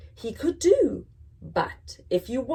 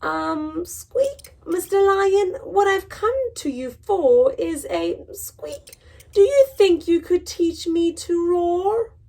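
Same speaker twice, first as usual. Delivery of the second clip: much higher, small squeaky plea; timid mouse voice; 9 semitones higher, 4 dB louder than usual. Acted. Mouse asks the lion to teach him, high and squeaky. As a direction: ask politely, small and high.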